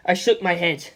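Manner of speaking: plain reading voice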